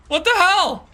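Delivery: high, startled what the hell; real disbelief, voice jumping up; sudden shocked outburst. Real, not acted.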